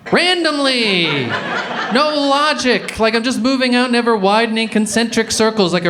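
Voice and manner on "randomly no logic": drawn out, high and pointed; slow high emphasis. Real, not acted. Comedian mocking wife's insinuation, slow and high. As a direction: stretch each word to mock the idea.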